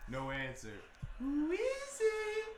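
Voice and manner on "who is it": high, drawn-out singsong call; calling to the door. Pretend. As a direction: sing out the question toward the door. Calling again after no answer.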